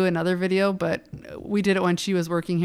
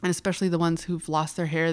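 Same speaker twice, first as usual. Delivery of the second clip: usual speaking voice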